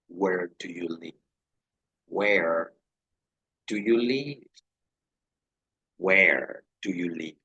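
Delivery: "Where" set apart, then a small gap before "do you live"; deliberate spaced drill; pause after where. Shown as a demonstration. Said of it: "Un espaciecito entre el where".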